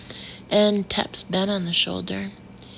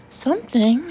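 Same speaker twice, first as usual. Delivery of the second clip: higher, rising echo of disbelief; incredulous; 6 semitones higher, 4 dB louder than usual. A narrator's reading. Narrator challenging Ben's answer, incredulous. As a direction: throw the word back as a question. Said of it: "stare at him incredulously".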